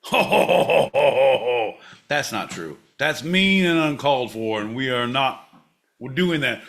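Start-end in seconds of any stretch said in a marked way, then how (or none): 0.0-1.7 mock Santa laugh.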